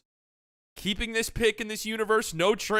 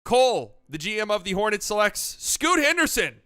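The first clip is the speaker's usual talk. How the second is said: higher, louder hyped-up draft host; draft broadcast host; 4 semitones higher, 4 dB louder than usual. Pretend. Hosting the mock draft for friends, hyped broadcaster. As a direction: sell each pick like live TV.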